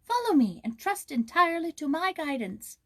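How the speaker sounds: high, earnest little voice; firefly urging. Acted. Firefly urges the prince to trust it, high-pitched. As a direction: coax him to follow, bright and high. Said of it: "it cried".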